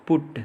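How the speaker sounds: पुट with a short उ. Shown as a demonstration. Teacher showing U said as उ, short vowel in पुट. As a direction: keep the "oo" in पुट brief. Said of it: "यू का उच्चारण उ की तरह".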